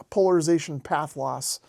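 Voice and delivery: ordinary delivery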